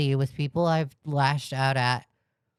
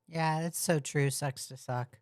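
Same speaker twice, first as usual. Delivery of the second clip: much softer, deadpan sarcasm; sarcastic murmur; 8 dB quieter than usual. Real, not acted. Streamer mocking a clip, soft deadpan sarcasm. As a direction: mutter it dryly.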